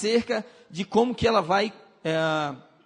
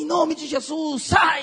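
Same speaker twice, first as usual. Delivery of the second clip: commanding rebuke; 6 semitones higher, 5 dB louder, slower than usual. Retold or imitated. Second exorcism command.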